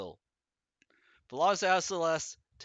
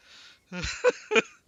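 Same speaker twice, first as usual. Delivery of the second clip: laughing out loud, high-pitched; high burst of laughter; 5 dB louder than usual. Real, not acted. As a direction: crack up suddenly.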